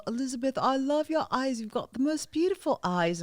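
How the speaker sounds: put-on admiring, amateur British accent. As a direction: imitate a British rocker sweet-talking in his accent. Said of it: "I might know my accent's horrible".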